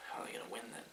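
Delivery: breathy whisper, almost no voice; whispered aside. Retold or imitated. Viewer doubting the hero can win, whispered. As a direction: let the doubt slip out as a whisper. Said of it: "you're like".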